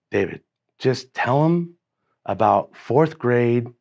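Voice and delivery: slow, drawn-out coaxing; spoon-feed the cue to a frozen speaker; gentle prompting. Retold or imitated. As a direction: prompt slowly, word by word. Coaxing a panicked, silent student along.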